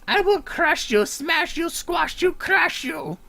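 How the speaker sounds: high, loud, laughing villain threats. Acted. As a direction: bellow at the little goblin. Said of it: "It's not the same voice I did for him before".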